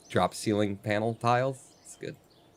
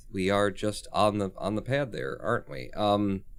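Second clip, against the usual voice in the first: ordinary delivery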